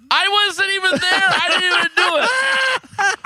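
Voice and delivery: very high-pitched, drawn-out cry; hammed-up high squawk of denial; mock outraged protest. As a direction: wail your innocence in comic indignation. Retells protesting his innocence.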